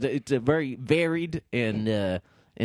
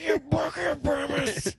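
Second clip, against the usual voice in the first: much higher, slow, crying wrestler wail; wailing Macho Man; slower than usual. Retold or imitated. Hammed-up Macho Man crying at Hulk, slow high wail.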